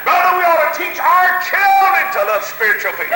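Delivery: rousing revival preacher; full-force preaching. Real, not acted. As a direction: drive each phrase up into a holler.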